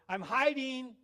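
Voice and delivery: a hint of a kid calling from hiding; small son hiding. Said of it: "when our son was small".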